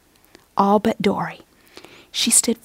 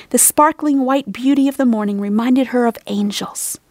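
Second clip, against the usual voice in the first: usual speaking voice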